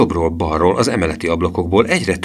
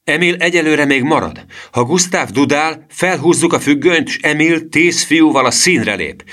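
high, raised commander's voice; urgent, high-pitched instructions; shouted orders; 9 semitones higher, 4 dB louder than usual. A narrator's reading. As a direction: call out the plan to the troop. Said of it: "ordította".

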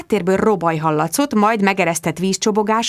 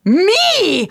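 high sharp cry; mock yell; 11 semitones higher, 4 dB louder than usual. Bridget yelling back at her mother, high. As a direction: blurt it out in shock. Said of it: "ordítottam".

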